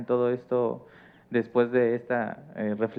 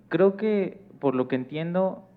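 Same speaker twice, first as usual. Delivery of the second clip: normal talking voice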